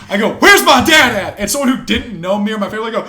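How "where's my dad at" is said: high, slow, very loud holler; retold shout. Fighter retelling his search for his dad, shouting. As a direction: yell out the question as if searching a crowd. Retold yell, told once more.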